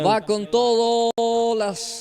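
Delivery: rising urgent play-by-play call; excited call. Real, not acted. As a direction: call the attack as it breaks. Penalty play unfolding live.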